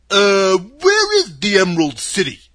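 loud, husky scarecrow question. A narrator's reading. Scarecrow inquiring about the city, husky. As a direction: inquire in a big swooping voice. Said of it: "in a rather husky voice".

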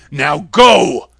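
commanding dismissal. A narrator's reading. Oz sending Dorothy away, loud command. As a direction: throw her out with a loud order.

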